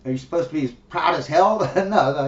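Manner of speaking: usual speaking voice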